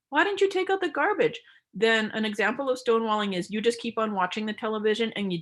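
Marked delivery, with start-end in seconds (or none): 0.1-1.4 high complaint; complaining. Shown as a demonstration. Demo of a partner coming home, complaining high. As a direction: confront them about the garbage. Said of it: "the partner comes home and goes".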